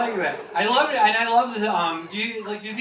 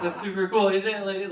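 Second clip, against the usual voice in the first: normal talking voice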